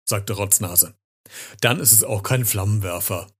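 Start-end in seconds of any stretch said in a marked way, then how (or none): none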